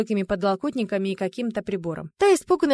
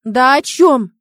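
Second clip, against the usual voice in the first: loud, higher, exasperated outburst; nervous cry; 4 semitones higher, 10 dB louder, much slower than usual. A narrator's reading. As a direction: cry out, rattled and demanding. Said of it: "нервно вскричал ботаник".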